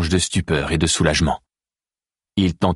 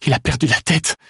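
alarmed cry; 8 semitones higher than usual. A narrator's reading. Alice panicking over Théo.

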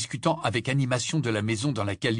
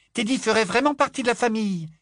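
put-on high dreamy girl voice; delighted little girl; 12 semitones higher, 4 dB louder than usual. A narrator's reading. Little girl dreaming of a wedding, high delighted voice. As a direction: sigh it out in rapture. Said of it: "avec ravissement".

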